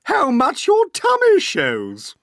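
high, loud, sneering aunt; Aunt Spiker crying out. Acted. Aunt Spiker mocking her sister, high and loud. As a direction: cut in with a shrill jab. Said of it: "Aunt Spiker cried".

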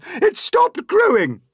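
very high, loud announcement; excited cry. A narrator's reading. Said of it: "Aunt Spiker cried".

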